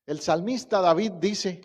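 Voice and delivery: normal talking voice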